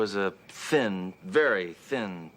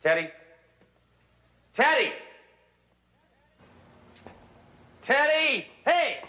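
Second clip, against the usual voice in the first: high, loud calls for Teddy; calling out; 5 dB louder, slower than usual. Acted. Detective searching the house calls out loudly for Teddy. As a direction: shout his name across the house.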